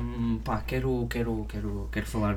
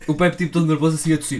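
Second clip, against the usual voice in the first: excited; 4 semitones higher, 8 dB louder than usual. Real, not acted. Excited storytelling, laughing.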